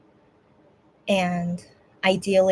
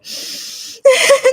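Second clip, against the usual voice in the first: tearful laugh; 7 dB louder than usual. Real, not acted. Teary laugh while crying.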